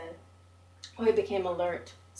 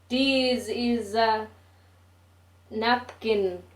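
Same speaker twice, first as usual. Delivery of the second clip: slow, higher mock Japanese-English accent; 3 semitones higher, 5 dB louder, much slower than usual. Narrator teasing Oi in a slow mock Japanese English accent. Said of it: "mimicking the first English learned".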